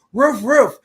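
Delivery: loud imitation woof; mocking dog bark. Taunting a troll as a hound dog, mocking bark.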